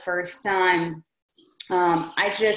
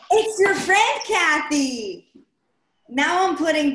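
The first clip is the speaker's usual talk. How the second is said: high and loud with delight; thrilled at the discovery; excited; 6 semitones higher, 4 dB louder than usual. Real, not acted. Realizes who her friend is.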